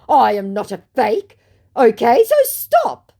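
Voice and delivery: hammed-up protest, slowing on the last words; indignant scammer. Voicing the scammer's indignant message.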